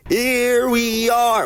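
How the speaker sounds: very high, slow sing-out; game title call. Retold or imitated. Vlogger imitating the ears' game title, high drawn-out call. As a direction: hold each word long and high. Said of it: "That's why it's called "Here we are".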